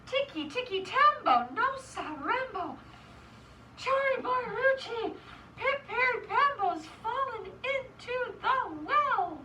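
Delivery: high, chopped-up plea with mild panting; acted breathless recital of the long name. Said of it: "he panted".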